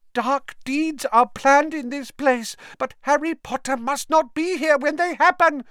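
high, loud, pleading elf voice; a humble house elf, high and eager; high squeaky house elf. Acted. Dobby telling Harry of the dark days, in a high elf voice.

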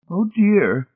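high-pitched, vexed matron. A narrator's reading. Mrs Pritchett faced with spilled tea, vexed. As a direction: exclaim in high-voiced vexation.

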